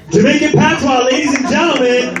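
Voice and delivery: high and laughing, present it to the crowd; laughing announcement. Real, not acted. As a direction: giggle through the announcement. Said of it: "announcing own joke, laughing".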